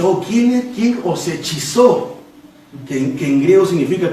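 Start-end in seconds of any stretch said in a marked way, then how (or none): 0.0-2.2 higher opening, then drawn-out rebuke; mild scolding. Acted. Pablo reproving the Galatians, mildly scolding. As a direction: reprove them, stretching out the question. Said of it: "empieza a llamarles la atención".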